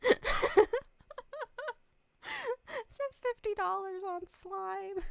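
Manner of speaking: high, slow, laughing disbelief. Real, not acted. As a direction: squeeze the words out through laughter. Laughing at own purchase.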